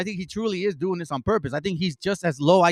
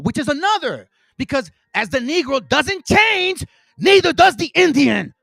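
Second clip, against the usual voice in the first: rising rant; 5 semitones higher, 7 dB louder than usual. Real, not acted. Ranting at misreading viewers.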